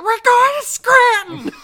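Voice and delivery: shrill high furious shout; Edith Bunker yell. Retold or imitated. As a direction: scream it like an angry housewife.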